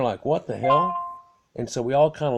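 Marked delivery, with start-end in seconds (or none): 0.2-0.9 louder, higher startled what the hell; jolted by the car wobbling, blurt it out; startled exclaim. Retold or imitated. Friends in the car startled by the wobble, loud exclaim.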